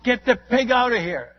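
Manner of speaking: loud, high shout to the chief; yelled order. Retold or imitated. Missionary yelling to the chief to remove the pig, loud shout. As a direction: bark it over the church chaos. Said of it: "I yelled".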